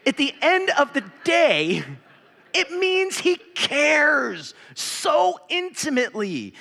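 fervent pulpit delivery; preaching at full force. Acted. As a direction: drive it home to the congregation.

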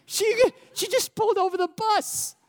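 amazed. Real, not acted. Amazed retelling.

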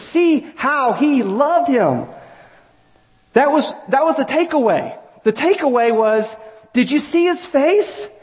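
high, ringing preaching cry; impassioned preaching. Real, not acted. Preacher correcting his old sermon for the congregation, impassioned. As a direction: preach it high, stretching the key words.

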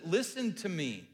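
slow urgent plea, voice lifted; pleading. Acted. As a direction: beg God not to turn away. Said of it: "he's asking God to listen".

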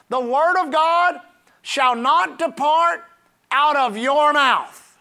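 very slow, weighty Bible proclamation; emphatic scripture reading. Real, not acted. Preacher reading the key verse to the congregation, slow emphatic proclamation. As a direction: declaim it word by deliberate word.

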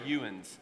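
"youans" in low-pitched country dialect; backwoods dialect. Shown as a demonstration. Pastor polling congregation on local word, backwoods dialect. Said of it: "you're from the backwoods of Blount County".

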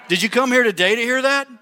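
ordinary talk

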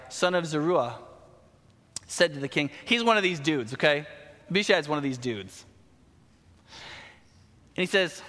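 ordinary delivery

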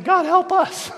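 high, loud comic plea; exasperated mock begging. Irritated disciples on their knees, exasperated loud begging. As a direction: beg loudly, at wits' end. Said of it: "get so irritated by it".

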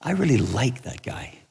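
soft, smiling affirmation; gentle, unhurried voice of Jesus. Acted. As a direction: answer quietly with a fond smile. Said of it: "Jesus smiles and goes".

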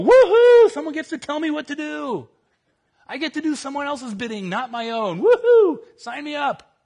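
sarcastic high squealing cheer; overjoyed fake enthusiasm; mock excitement. Mock enthusiasm about obedience.

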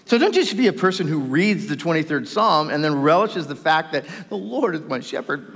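ordinary talk, nothing marked

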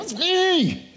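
very high comic call-out; high, drawn-out mock reassurance; hammed-up announcement. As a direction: sing out the correction in a silly high voice. Mock correction of the grand line.

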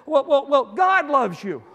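high, loud, stammered reassurance; urgent pleading. Acted. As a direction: blurt it out to save him. Rescuer pleading with a jumper.

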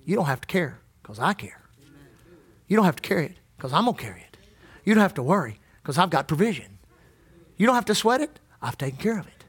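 low, soft reassuring voice of God; a soft, low comforting God; tender reassurance. Retold or imitated. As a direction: gently promise to carry it all. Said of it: "He said".

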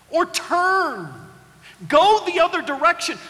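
a patient creator explaining his plan; a hint of a wise God speaking; voicing God. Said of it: "The body that God said".

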